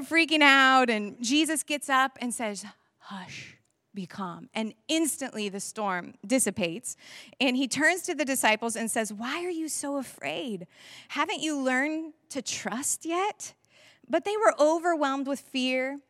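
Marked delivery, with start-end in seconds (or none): 3.0-4.5 soft whisper; barely-voiced command to be calm; whispered hush. Jesus calms the storm. 9.1-13.5 gentle rebuke. Acted. Jesus gently rebukes disciples.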